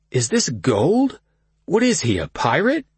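cousin Kyle voice. A narrator's reading. Kyle mocking the boss.

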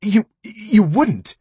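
very high slow stuttering dread; terrified stammer. Acted. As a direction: stammer it in horror. Terrified woman near train.

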